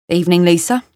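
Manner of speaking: London man's brief greeting; London accent. Acted. London man greets Lisa, London accent. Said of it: "More London".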